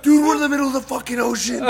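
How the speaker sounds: high, loud, hammed-up panic; panicked protest. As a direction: yell it like a panicking passenger. Panicked reply on sinking boat.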